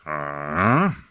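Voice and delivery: low, sceptical rising query; heavy sarcasm. A narrator's reading. Master doubts his apprentice's idea, heavily sarcastic. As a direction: draw out a doubting low response. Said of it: "inquired Hein Huss with heavy sarcasm".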